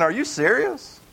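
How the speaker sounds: dude, are you kidding me?; mock stunned disbelief; incredulous. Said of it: "Cleopas is basically saying".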